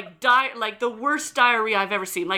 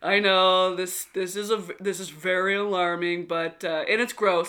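lower, laid-back young man, lightly voiced; poison control guy; 6 semitones lower than usual. Mom voicing the poison control guy, calmly reassuring. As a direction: drop lower and reassure casually. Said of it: "this guy, he must have been".

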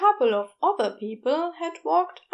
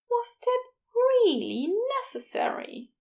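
higher, embarrassed mock complaint; complaining; 11 semitones higher than usual. A narrator's reading. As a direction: Aziraphale embarrassed by Crowley's flirting, complaining. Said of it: "he complained".

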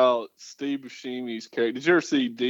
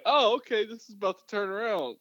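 hopeful excitement; 9 semitones higher than usual. Retold or imitated. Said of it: "and I'm like".

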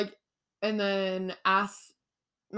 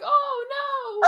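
high, loud wail of dismay; crying out oh no; 7 dB louder than usual. Retold or imitated. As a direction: wail it like a distraught fan. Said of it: "I was saying no".

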